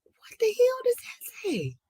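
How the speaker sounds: high, airy, drawn-out disbelief; baffled whisper. Real, not acted. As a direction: squeeze it out slowly in a baffled whisper. Baffled by an unreadable name.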